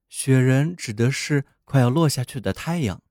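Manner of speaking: plain reading voice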